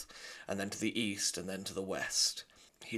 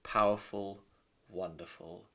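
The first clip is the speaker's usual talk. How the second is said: usual speaking voice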